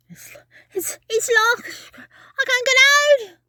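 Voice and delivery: from stuttering hush to panicked cry; trapped child panicking at the door; rising panic. Acted. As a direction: stammer building to a high loud cry.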